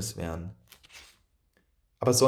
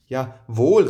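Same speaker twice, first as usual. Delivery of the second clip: exclaiming; 7 dB louder than usual. A narrator's reading. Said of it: "rief er".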